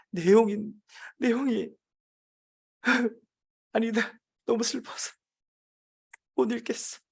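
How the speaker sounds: high, halting, choked-up act; staged tearful breakdown with broken pauses; showman's fake sorrow. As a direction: pretend to be too upset to go on. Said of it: "쇼맨십을 발휘하기로 했습니다".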